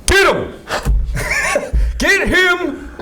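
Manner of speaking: a hammed-up cop yelling "Get him!"; mock cop shout. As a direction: yell it like an overeager cop, laughing. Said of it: "they're just like".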